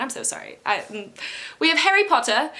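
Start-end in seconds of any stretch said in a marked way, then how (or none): 1.6-2.5 hammed-up British accent, badly faked; bad English accent. Vlogger retrying the character's name, bad English accent. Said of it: "still a really bad English accent".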